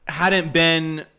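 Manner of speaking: ordinary talk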